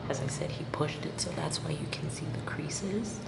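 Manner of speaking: soft unvoiced whisper. Real, not acted. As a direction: whisper to the camera. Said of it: "I have to whisper this".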